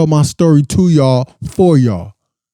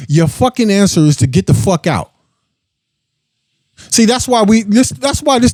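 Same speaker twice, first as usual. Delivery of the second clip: hammering it home, higher and faster; fed-up rant; 5 semitones higher than usual. Real, not acted. Telling viewers off straight to camera, heated and higher. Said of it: "let me look at the fucking camera and say this shit".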